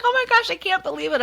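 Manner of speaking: fast, high-pitched amazement; retold excitement. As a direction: gush it quickly, imitating a thrilled coworker. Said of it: "she was so excited".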